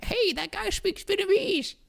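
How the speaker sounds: very high, slow, put-on grandma voice; old grandma. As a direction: squeak it out like a delighted old grandma. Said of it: "old grandma was like".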